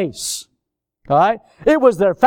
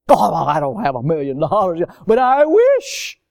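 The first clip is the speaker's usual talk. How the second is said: fake generosity. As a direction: feign longing to give, swelling at the end. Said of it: "It's a fraud. It's fakery".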